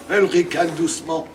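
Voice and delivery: ordinary delivery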